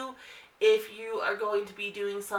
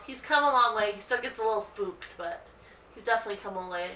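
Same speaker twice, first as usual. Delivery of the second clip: ordinary talk, nothing marked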